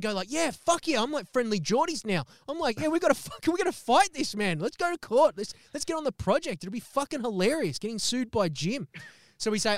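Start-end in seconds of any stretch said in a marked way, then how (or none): 0.3-8.9 high, fired-up rallying; self-righteous hype. Retold or imitated. [name] getting pumped up to fight Jim in court, high. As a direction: rouse the troops for a fight. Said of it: "I'm starting to feel self-righteous".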